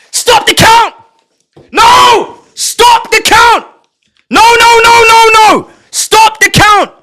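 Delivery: desperate repeated yelling; frantic shouting. Real, not acted. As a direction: protest at the top of the voice. Frantic protest at the screen.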